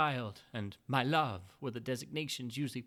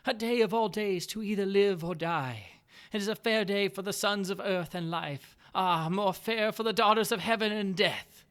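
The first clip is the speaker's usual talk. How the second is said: higher and louder, rapturous dying wife; Morella's fevered voice; 6 semitones higher, 8 dB louder than usual. A narrator's reading. Dying Morella tells her husband of life and death in a raised, exalted voice. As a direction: let her voice soar with eerie exaltation. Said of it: "she said".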